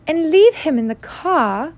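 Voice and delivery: slow, high aunt voice; slowly. A narrator's reading. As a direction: finish the thought slowly, pitched high. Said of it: "said Aunt Petunia slowly".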